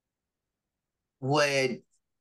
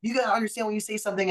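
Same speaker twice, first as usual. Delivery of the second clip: angry boss; 9 semitones higher, much faster than usual. Retold or imitated. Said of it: "he's like".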